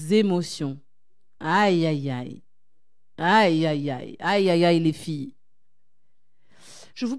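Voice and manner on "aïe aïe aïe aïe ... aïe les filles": mock wince. Réaction à l'émotion.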